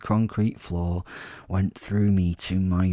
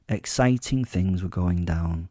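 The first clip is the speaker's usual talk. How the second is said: usual speaking voice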